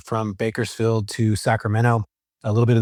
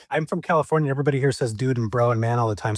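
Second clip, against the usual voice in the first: normal talking voice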